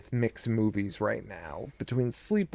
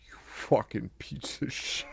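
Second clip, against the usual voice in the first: slow, unvoiced-sounding whispery insult, much softer; hushed mock scolding; 9 dB quieter, slower than usual. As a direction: whisper the curse slowly, mimicking an angry mother. Acted mother berating her son.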